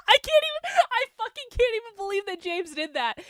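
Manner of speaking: high laughing shout of disbelief. Real, not acted. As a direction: burst out laughing, can't believe it. Disbelief at rival's report.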